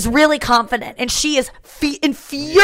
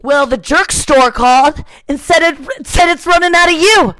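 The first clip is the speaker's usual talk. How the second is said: triumphant loud comeback; George; 4 semitones higher, 6 dB louder than usual. Retold or imitated. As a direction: build to a loud, high punch on the last word. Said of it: "and goes".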